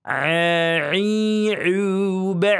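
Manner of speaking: "A, i, u, ba" squeezed from the middle of the throat; pressed throat ain chant; ain drill. Shown as a demonstration. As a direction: tighten the throat on each "A". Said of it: "Di tengah tenggorokan".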